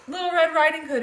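plain reading voice

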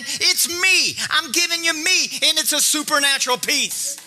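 high, driving sermon delivery; full-force preaching. Real, not acted. Preacher urging the congregation to take courage, forceful. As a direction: preach it with rising conviction.

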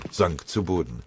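ordinary delivery

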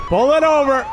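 high, loud cop order; commanding shout. Acted. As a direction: bark the order to pull over. Cop ordering driver to stop.

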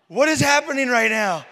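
loud, high retold disbelief; 震惊质问. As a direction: blurt it out, aghast at the scene. Retold shock at her behaviour.

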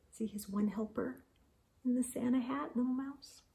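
hushed comment; soft aside. Real, not acted. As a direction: drop your voice to point something out. Quiet aside pointing at picture.

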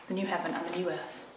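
ordinary talk, nothing marked

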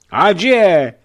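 put-on repeated drunken toast; loud toast. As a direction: shout the toast back. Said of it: "opakoval rovněž po několikáté Bennett".

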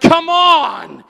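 loud, high exasperated "come on"; frustrated yell. Retold or imitated. Pastor retelling being passed on his bike, yelling. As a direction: belt it out at the riders passing you. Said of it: "I'm like".

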